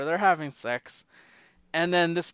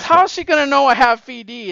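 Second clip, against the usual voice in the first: loud, high acted objection; mock protest; 8 semitones higher, 11 dB louder than usual. Naked teammate objecting to an inspection, loud mock protest.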